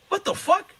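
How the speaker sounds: high-pitched retold bafflement; incredulous, pitched well up; shocked disbelief. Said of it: "this is how shocked I am".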